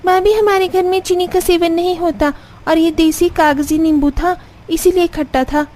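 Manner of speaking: put-on high-pitched young woman's voice; high and insistent character voice; bickering sisters-in-law.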